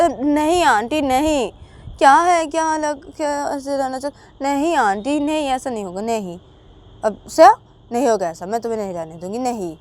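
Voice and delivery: high, loud firm no; loud refusal. Acted. Shruti loudly refusing to let Shreya stay.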